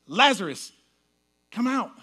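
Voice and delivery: calling out loud and high, commanding. Retold or imitated. Preacher calling Lazarus out of the grave, loud commanding call.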